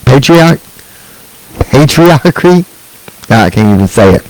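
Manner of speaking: ordinary talk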